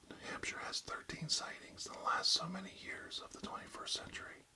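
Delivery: ASMR whisper, close to the mic; barely audible, intimate whisper. Acted. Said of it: "real close to inaudible whispers today".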